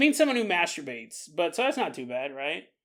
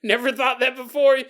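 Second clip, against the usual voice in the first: higher, laughing, louder listener's denial; laughing mock denial; 7 semitones higher, 6 dB louder than usual. Listener laughing off the idea, laughing. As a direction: scoff it off through laughter. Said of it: "You're like".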